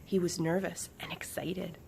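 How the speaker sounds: plain reading voice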